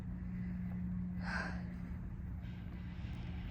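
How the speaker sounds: long, quiet breath out; long sigh. Real, not acted. Cramping expectant mother wonders about labor, sighing long. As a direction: let out a drawn-out sigh.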